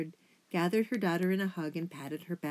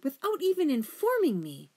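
high, loud, affronted sputter; 10 semitones higher, 6 dB louder than usual. A narrator's reading. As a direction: sputter with wounded indignation. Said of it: "Lungwort sputtered".

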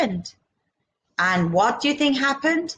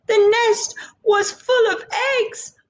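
wailing; 12 semitones higher than usual. Acted. Bushfowl mother wails over her broken eggs. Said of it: "wailed the bushfowl".

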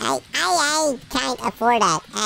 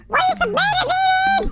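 mock wail; much faster than usual. Mock despair at himself.

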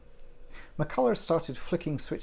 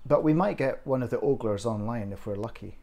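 plain reading voice

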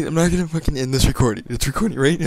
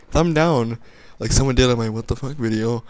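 normal talking voice

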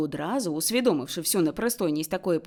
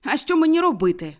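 loud, indignant objection; indignant retort; 6 semitones higher, 7 dB louder than usual. A narrator's reading. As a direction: snap back at the nephew. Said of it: "заперечив Скрудж". (обурене заперечення)

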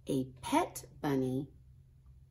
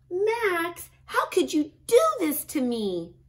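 much higher, louder wail of dismay; dismayed big sister; 12 semitones higher, 8 dB louder than usual. A narrator's reading. Ruby finding the chocolate chicken eaten, a much higher and louder wail. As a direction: cry out like a betrayed sister.

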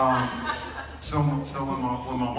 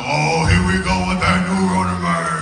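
slightly higher grumbling impression of older folks; mock grumbling elders; 5 semitones higher, 4 dB louder than usual. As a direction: imitate an older crowd's complaint. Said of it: "everybody over thirty-five go".

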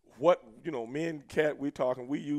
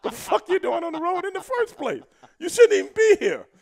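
loud, high-pitched mock outrage; indignant dismissal; 10 dB louder than usual. Veteran telling how they treated the rookie, loud high indignation. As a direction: dismiss him loudly, voice pitched way up.

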